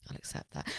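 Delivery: whispered echo. Pretend. Cohost repeats the line back in a voiceless whisper. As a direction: murmur it back under the breath, voiceless.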